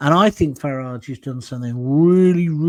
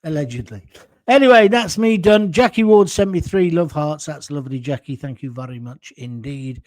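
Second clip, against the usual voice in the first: ordinary delivery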